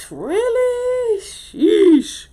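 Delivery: high, drawn-out mock surprise. Drew hears the gossip from Nate, high mock surprise. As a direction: play Drew's shocked gossip reaction. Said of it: "And Drew like".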